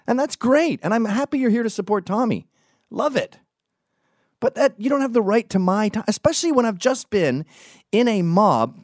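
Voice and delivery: high-pitched indignant tirade; exasperated rant. Retold or imitated. Venting about fans filming him after the mob, high exasperated rant.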